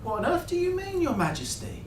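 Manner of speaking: high-pitched mock protest; mock deference. A narrator's reading. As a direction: play the put-upon servant, pitched high. Mock deference to a bossy passenger.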